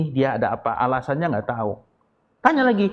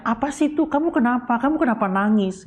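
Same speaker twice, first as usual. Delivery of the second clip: high-pitched acted questioning; concerned questioning; 9 semitones higher than usual. Speaker asking someone why they are crying, acted concerned questioning. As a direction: ask in a raised, role-played voice what is wrong.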